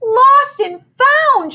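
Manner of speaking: high, slow, horrified shriek. Acted. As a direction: shriek it in sheer terror. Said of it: "shrieked Missy".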